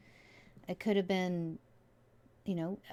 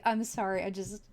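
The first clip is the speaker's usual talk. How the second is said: usual speaking voice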